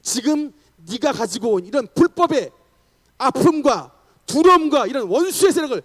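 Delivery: loud, high exorcism command; pastor's forceful cry of rebuke; shouted declaration. Acted. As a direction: build to a full shout banishing the enemy. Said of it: "라고 외쳤는데".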